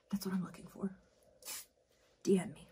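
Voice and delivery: breathy aside whisper; conspiratorial whispered aside. Real, not acted. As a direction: whisper it like a secret.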